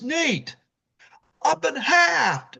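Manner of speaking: high, slow mock-feeble kid; dying kid voice. Cut-in-half boy telling his brother, high and slow. Halved boy in retold movie.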